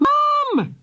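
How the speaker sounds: put-on high desperate child scream. A narrator's reading. Philly screams as the lava arrives. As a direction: scream for mum. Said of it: "screamed Philly".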